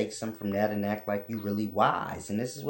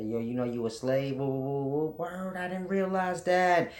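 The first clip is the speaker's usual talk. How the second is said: mock woke listener; 5 semitones higher than usual.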